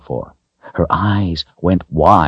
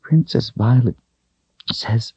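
put-on high, halting child voice; tearful little girl; 7 semitones higher than usual. A narrator's reading. Girl telling her troubles to the doll, tearful. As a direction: confide it haltingly, close to tears.

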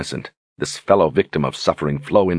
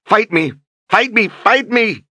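each "Fight me!" climbs higher and louder; tearful furious scream; 4 dB louder than usual. A narrator's reading. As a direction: shriek through tears, building. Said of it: "Tears streamed from her eyes".

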